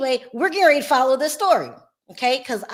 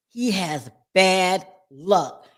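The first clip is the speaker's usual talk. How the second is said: very slow, lower, he stressed; slow low repeat; 5 semitones lower, much slower than usual. Shown as a demonstration. Confirming to viewers, very slow low emphasis. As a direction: drag it out heavily. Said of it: "when I tell you guys".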